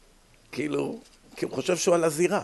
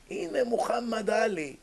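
retold excited call; 7 semitones higher, 3 dB quieter, slower than usual. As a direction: imitate a friend calling out, stretched and high. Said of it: "החבר שלי צועק".

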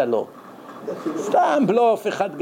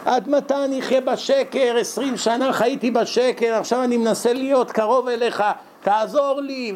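imitated tearful, crying-out prayer; 6 semitones higher than usual. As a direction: beg your Father for rescue in a raised, wailing pitch. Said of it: "בוכה, צועק בתפילה". (חיקוי של זעקת תפילה בוכייה)

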